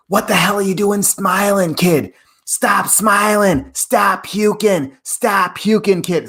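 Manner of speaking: high, drawn-out Boston coach yell. Retold or imitated. Coach scolding runner on a hill workout, Boston holler. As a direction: imitate a hard-nosed Boston coach hollering. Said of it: "my coach is this Boston guy".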